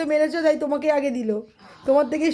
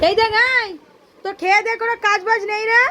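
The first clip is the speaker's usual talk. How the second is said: scolding shout; 7 semitones higher than usual. Real, not acted. Mother snaps at pestering child.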